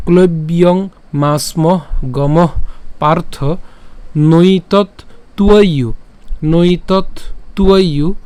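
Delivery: slow, word by word, with pauses; demonstration recitation. Shown as a demonstration. Teacher repeating the first line for learners, slow and emphatic. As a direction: recite each Sanskrit word firmly and separately. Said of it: "প্রথম লাইনটিকে যদি আমি আরেকবার উচ্চারণ করি".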